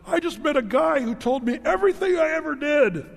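high, loud, thrilled announcement; bursting with news to tell the town; mock giddy woman; excited woman. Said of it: "and she's like".

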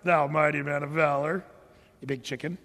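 sarcastic jab at a coward. Pretend. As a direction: sneer it as a put-down. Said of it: "did he say it with sarcasm?".

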